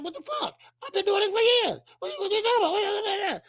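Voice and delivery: high-pitched, far-off imitation of an outraged ref; mock outrage. Imitating Robin Garcia protesting in disbelief, high and outraged. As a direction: squeak it out like a baffled official.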